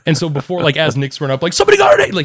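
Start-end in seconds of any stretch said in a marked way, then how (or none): 1.5-2.1 very high, loud cry; imitating a wildly excitable friend; Nick, excited. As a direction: shriek the news in mock excitement. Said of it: "he's an excitable type".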